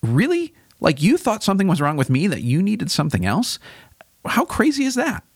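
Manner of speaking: incredulous, high-pitched challenge; acted-out indignant disbelief; accusatory. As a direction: leap up in pitch on the questions, accusing.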